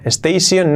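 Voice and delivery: "station" as es-tei-SION; added e and s sound in "station"; wrong pronunciation. Shown as a demonstration. Teacher rejects this version: "station" as es-tei-sion. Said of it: "station no".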